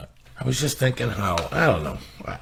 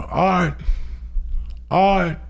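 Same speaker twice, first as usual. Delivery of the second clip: high-pitched searching call; 10 semitones higher than usual. Retold or imitated. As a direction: sing out the name to see who's there. Calling deeper into the office.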